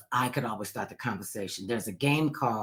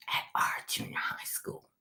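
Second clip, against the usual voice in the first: whispered; 9 dB quieter than usual. Real, not acted. Dreading aside.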